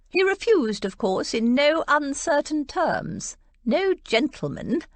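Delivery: a lightly voiced haughty aunt, pleased with herself; smug satisfaction. A narrator's reading. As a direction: savour the refusal a little. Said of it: "with thinly disguised satisfaction".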